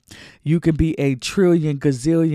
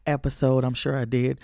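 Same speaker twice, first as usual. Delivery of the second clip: normal talking voice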